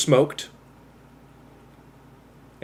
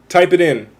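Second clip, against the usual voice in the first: usual speaking voice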